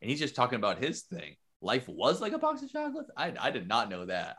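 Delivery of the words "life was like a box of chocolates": incredulous question. Real, not acted. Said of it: "that's wild".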